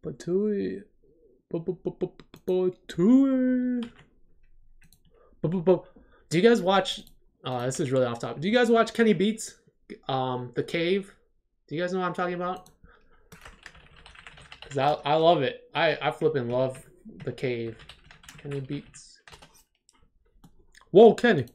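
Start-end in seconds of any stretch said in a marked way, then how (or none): 0.0-3.8 mock spit. Mock spitting noise. 20.9-21.5 loud, high Whoa Kenny hype call; a loud imitated producer drop; imitating the producer tag. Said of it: "but his tag is".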